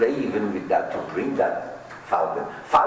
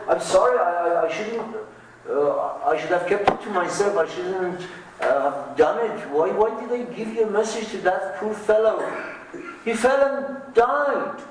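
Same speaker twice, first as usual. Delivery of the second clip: high, pleading merchant voice, stammering; a sorry merchant blurting out his regret; merchant's apology; 11 semitones higher than usual. Retold or imitated. Merchant home from India apologizing to his parrot, high and pleading. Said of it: "He came back and he said".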